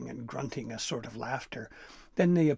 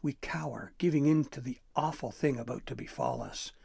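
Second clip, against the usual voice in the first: usual speaking voice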